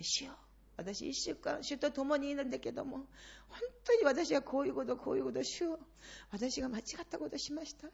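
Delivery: 小さな声で主に打ち明ける祈り; quiet humble prayer. Shown as a demonstration. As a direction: speak softly, confessing to the Lord. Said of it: "それがあなたたちの祈りなんです". (静かにへりくだって祈る)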